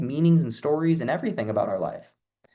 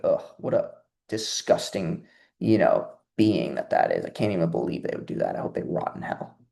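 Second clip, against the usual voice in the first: low disgusted grumble; 7 semitones lower than usual. Retold or imitated. Viewer reacting to shooter news.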